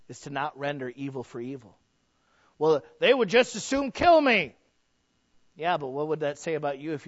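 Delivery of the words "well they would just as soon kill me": raised, louder protesting voice; acted objection. Acts out a listener's objection.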